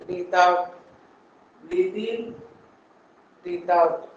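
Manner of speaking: pace the class's breath; guided breathing cues. Acted. Yoga teacher guiding the class's breathing.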